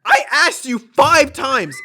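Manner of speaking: loud, high, slow indignation; hammed-up fed-up clerk; exasperated outburst. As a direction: spell out the grievance at full volume.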